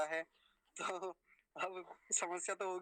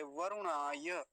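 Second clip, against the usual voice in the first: वरुणाय very slowly, syllable by syllable; slow part demo; much slower than usual. Shown as a demonstration. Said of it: "बस इतना बोलेंगे सबसे पहले". (वरुणाय धीरे धीरे)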